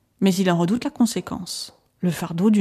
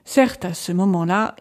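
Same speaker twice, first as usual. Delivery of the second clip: usual speaking voice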